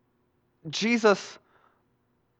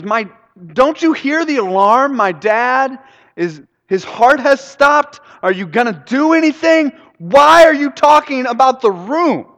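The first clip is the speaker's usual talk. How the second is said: high, loud, desperate outburst; frustrated shouting; 10 semitones higher, 6 dB louder than usual. Acted. Son begging help as dad's heart stops, frustrated yelling. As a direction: plead and yell with mounting exasperation. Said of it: "gets really frustrated".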